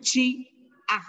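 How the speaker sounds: "chi-ah" cut in two by a pause; apostrophe break. Shown as a demonstration. Teacher explaining the apostrophe, "chi-ah" in two separate beats. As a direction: stop after "chi", then add "ah". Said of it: "entonces se pronuncia".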